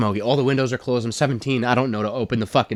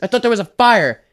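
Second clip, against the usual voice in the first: higher, louder frantic dad; dad who thought his son was dead, higher and louder; panicked dad; 12 semitones higher, 4 dB louder than usual. Retold or imitated. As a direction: cry it out in relieved panic. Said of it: "He's like".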